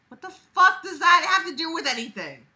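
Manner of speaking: exasperated yell; incredulous. Real, not acted. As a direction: react in disbelief. Incredulous outburst.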